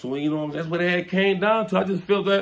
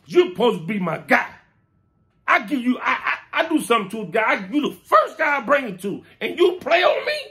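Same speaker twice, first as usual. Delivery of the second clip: higher, wounded pleading; hurt plea; 8 semitones higher than usual. Retold or imitated. Higher hurt plea to the friend who betrayed him. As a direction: plead like he betrayed you, voice rising. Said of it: "tears coming down my eyes".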